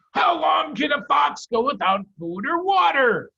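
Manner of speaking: high, loud gruff farmer voice; farmer Boggis asking. Acted. Boggis asks the other farmers, high and loud. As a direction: shout it as an impatient farmer. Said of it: "Boggis asked".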